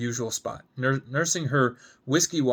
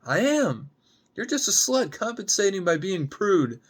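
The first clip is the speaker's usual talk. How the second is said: high, cutting comeback; angry retort; 8 semitones higher than usual. Acted. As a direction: throw the insult right back. Alan insults Gemma back.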